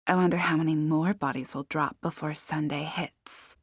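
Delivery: soft, whispered musing; ominous whisper. A narrator's reading. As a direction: whisper it as a dark aside. Guest muses on more deaths.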